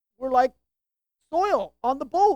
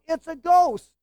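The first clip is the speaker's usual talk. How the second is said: mildly frightened cry, raised in pitch and level; fearful cry; 5 semitones higher, 5 dB louder, slower than usual. Acted. Disciples seeing a figure on the water, fearful cry. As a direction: cry it out in mock alarm. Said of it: "in fear they cried out".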